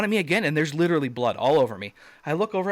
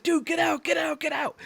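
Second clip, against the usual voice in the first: very high, strained mock yell; imitated panic. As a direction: imitate a panicked buddy telling everyone to flee. Buddy yells to flee the goat.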